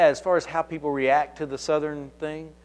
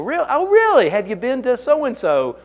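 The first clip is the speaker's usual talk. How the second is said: higher and louder, eager hometown chatter; mock eager surprise; 10 semitones higher, 6 dB louder than usual. Pretending kinship to the caller, eager and higher-pitched. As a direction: play up the thrill of finding common ground. Retold call, eager recognition.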